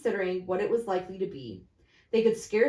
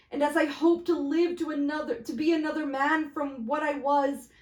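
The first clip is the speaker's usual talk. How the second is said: put-on raised, fervent old man pleading; earnest Scrooge; 7 semitones higher, 5 dB louder than usual. Scrooge pleading with the ghost of the future, raised. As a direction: beg the phantom with trembling earnest.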